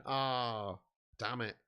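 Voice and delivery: normal talking voice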